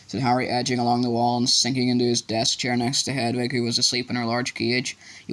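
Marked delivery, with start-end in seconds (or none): none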